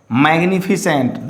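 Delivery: normal talking voice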